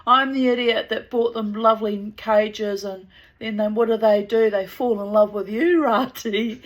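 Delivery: playfully put-out quoted protest; a bit upset. Retold or imitated. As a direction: complain with mock hurt, ending in a laugh. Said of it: "was a bit upset".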